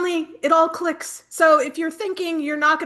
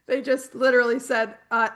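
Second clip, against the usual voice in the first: usual speaking voice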